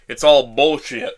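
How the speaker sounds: angry outburst. A narrator's reading. Man snapping at his dead girlfriend's ghost, loud. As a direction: spit it out hard.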